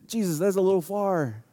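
hesitant, held-back mock protest; tentative acted-out reproach; awkward objection. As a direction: object gingerly, as if embarrassed for him.

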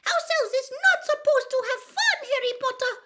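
loud, very high elf squeak; Winky, firm. A narrator's reading. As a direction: lay down the rule in a tiny squeak. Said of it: "said Winky firmly from behind her hands".

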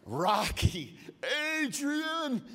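a rough Rocky voice that leaps high on "Adrian"; hint of a Rocky Balboa yell; Rocky impression. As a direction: call out for Adrian the way Rocky does. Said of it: "every single Rocky ever made".

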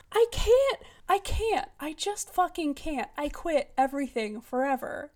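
high wail sinking back to normal pitch; exasperated giving up. Real, not acted. Host gives up on the book after ranting, exasperated.

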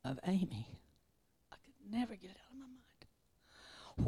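ordinary talk

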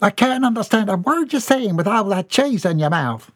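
a fussing mother's complaint; high exasperated mother voice; scolding mother. A narrator's reading. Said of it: "mother scolds son".

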